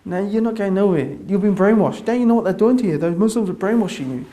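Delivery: mock the wife's alarmed refusal; lightly voiced, indignant wife; wife objecting. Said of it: "And my wife said".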